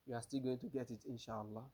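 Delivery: ordinary talk, nothing marked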